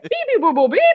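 high mock computer beeps; teacher frozen like a crashed computer, high beeps; robot beeping. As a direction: imitate a crashing machine, beep boop. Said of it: "She, like".

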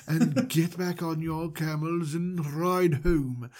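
slightly odd, drawn-out host voice; mildly creepy, unhurried character voice; Mister Manager. Acted. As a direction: lightly put on a slow, strange voice. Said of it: "his voice sounds a little different".

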